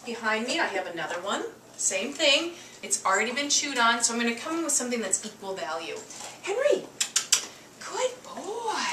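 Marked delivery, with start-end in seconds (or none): 6.4-8.8 very high sweet praise; dog praise. Real, not acted. Owner praising Henry during the trade in a high coo.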